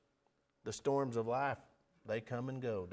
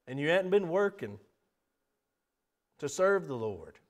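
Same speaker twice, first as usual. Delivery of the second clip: raised, pleading altar call; earnest invitation plea; 3 semitones higher, 6 dB louder than usual. Acted. Preacher calling the weary forward, raised pleading voice. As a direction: call them forward, building.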